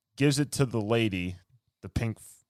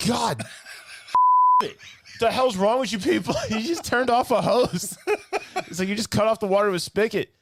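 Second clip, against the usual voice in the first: high, loud laughing disbelief; exasperated rant; 10 semitones higher, 4 dB louder than usual. Real, not acted. Friend recapping a bad kids show, in exasperated laughing disbelief. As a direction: rant at a dumb cartoon, half laughing. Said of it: "this show made you slightly angry".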